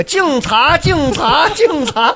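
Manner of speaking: high, chanted crosstalk call; Guo Degang impression. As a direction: mimic a crosstalk performer's call. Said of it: "就跟郭德纲那个".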